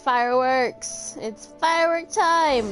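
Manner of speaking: loud, drawn-out, rising call; whooping with fireworks excitement; excited cheer. Real, not acted. Cheering as fireworks are about to start.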